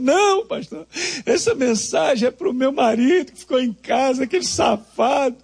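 high, indignant woman complaining about her husband; mock outraged wife, high-pitched; indignant churchwoman. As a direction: squeal it out like a scandalized church lady. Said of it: "E ela chorando".

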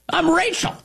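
unconvincing, very high falsetto female voice; Admiral Rachel Levine. Acted. Host mocking the admiral's introduction, unconvincing falsetto female voice. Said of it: "in the least convincing female voice ever".

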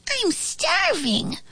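high, slow, soft whine; moaning. A narrator's reading. Hungry flea to cage mates, high moaning. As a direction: moan it in a tiny high voice. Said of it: "moaned the flea".

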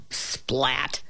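soft, whispered impact word; whispered sound effect. A narrator's reading. Narrator voices the dropping landing.

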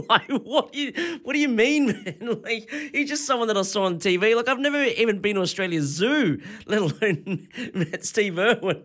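real laughter, incredulous; laughing disbelief. Real, not acted. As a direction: laugh at how absurd it was. Laughing at the absurd encounter.

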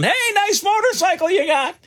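high-pitched sarcastic holler after a rider; retold yell. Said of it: "I'm yelling".

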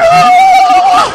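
very high shrieked ooh; hammed-up high shriek; mock shriek. Scream in the rant.

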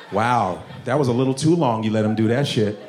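ordinary talk, nothing marked

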